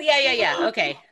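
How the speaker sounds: usual speaking voice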